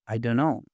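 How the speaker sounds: "I don't know" blended into "I dunno"; quick linked "I don't know", the t dropped; connected speech. Shown as a demonstration.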